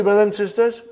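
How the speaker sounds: usual speaking voice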